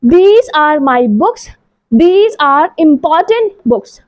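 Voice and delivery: very slow and clear; slow model sentence. Shown as a demonstration. Teacher modeling for pupils, very slow. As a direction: say it slowly for the children. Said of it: "तो देखिए जल्दी से".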